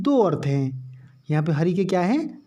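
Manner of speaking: ordinary talk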